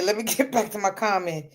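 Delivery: normal talking voice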